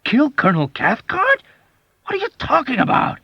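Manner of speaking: high, loud panicked disbelief; terrified. A narrator's reading. Terrified airman reacting to the murder plan. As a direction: gasp it in wild terror. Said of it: "wildest terror".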